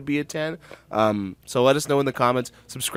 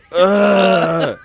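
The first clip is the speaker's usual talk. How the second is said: loud, raised, drawn-out groan; mock groan; 7 semitones higher, 8 dB louder than usual. Host imitates singer on camera, long loud groan. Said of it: "He's just groaning".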